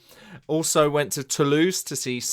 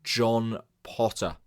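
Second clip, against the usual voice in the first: ordinary talk, nothing marked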